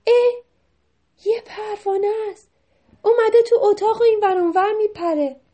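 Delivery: high, loud exclamation; delighted surprise. A narrator's reading. Mehrabun spots a butterfly, high loud surprise. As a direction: exclaim at a sudden discovery. Narrator voices discovery of a butterfly.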